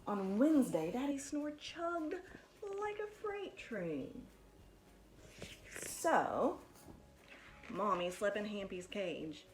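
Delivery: lively, animated picture-book reading; bouncy storytime voice with big swoops; reading to children. Acted. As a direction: read it like storytime for little kids. Teacher reads picture book aloud.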